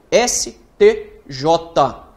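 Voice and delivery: slow, loud "S T J", each letter separate; emphatic letter-by-letter spelling. Shown as a demonstration. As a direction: spell out S T J with weight. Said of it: "Eu tô falando letra a letra".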